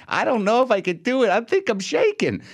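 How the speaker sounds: high-pitched, whiny self-doubt; mock nervous surgeon. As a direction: lampoon a surgeon losing his nerve. Mocking an insecure surgeon.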